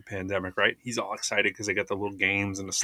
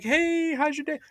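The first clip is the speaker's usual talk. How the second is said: much higher, sing-song mock cheer; fake friendly greeting; slower than usual. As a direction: parody a fake-nice server. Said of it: "I'm like".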